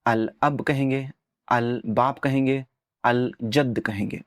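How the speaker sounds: "अल" said with a clearly sounded L before each word; clear lam. Shown as a demonstration. Teacher showing students the clear lam of izhar. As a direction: pronounce the lam of "अल" fully, a slightly slow demo. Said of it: "लाम को clearly pronounce करेंगे".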